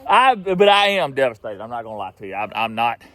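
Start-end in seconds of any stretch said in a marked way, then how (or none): none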